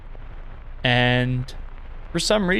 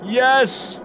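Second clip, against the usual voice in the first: excited yes; 10 semitones higher than usual. Real, not acted. Player cheers on learning she passed the quiz. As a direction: celebrate the win.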